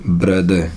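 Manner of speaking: ब्रदर as "bra-duh", R dropped; silent final R. Shown as a demonstration. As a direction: end ब्रदर on an open vowel. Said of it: "ईआर का उच्चारण नहीं होता".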